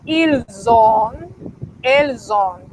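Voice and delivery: ils ont and elles ont with a buzzing Z link; French liaison. Shown as a demonstration. Teacher demonstrating plural ils ont, Z liaison. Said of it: "En plural decimos".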